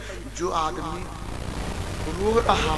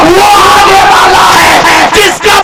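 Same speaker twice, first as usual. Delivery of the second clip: loud, slightly higher fervent sermon; full-force preaching; 5 semitones higher, 13 dB louder than usual. Acted. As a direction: proclaim the miracle to the crowd. (पूरे जोश से ऊँची आवाज़ में तक़रीर)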